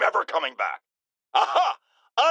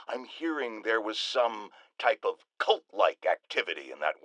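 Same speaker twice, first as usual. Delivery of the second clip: conspiratorial hush; 7 semitones lower, 5 dB quieter than usual. Acted. Host hints at a secret cult.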